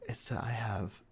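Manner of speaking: hushed confession; whisper. Acted. Lover confessing his fears.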